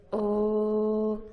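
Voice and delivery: "Oh" sustained through circled lips; rounded oh vowel. Shown as a demonstration. Teacher showing lip shape, rounded oh. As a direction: hold a steady, round "Oh". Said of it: "Make a circle with your lips".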